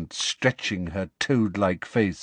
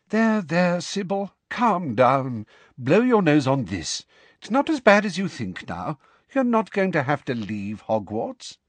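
a touch higher, brisk but kind; firm comfort; 7 semitones higher than usual. Acted. McGonagall comforting the sobbing teacher, brisk and kind. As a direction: soothe her briskly, like a stern aunt.

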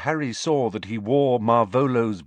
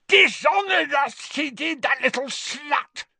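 shouted; 8 semitones higher than usual. Acted. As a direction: spit out the insults in rage.

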